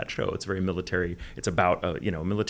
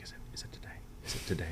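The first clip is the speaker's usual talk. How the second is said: hushed, eager asking under the breath; breathless little whisper, almost mouthed; 10 dB quieter, faster than usual. Retold or imitated. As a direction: whisper it like a kid who can't wait. Eager actor pestering the director.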